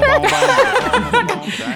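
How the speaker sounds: long high laugh; real high-pitched laughter. Real, not acted. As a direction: crack up at a joke. Laughs at a listener's joke.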